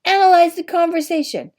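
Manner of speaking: sharp, loud, high command; high, loud shout of a teacher; cried out. A narrator's reading. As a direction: bark it out like a fencing master. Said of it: "had cried out instantly".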